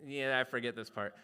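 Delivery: ordinary talk, nothing marked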